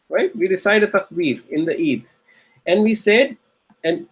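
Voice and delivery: ordinary talk, nothing marked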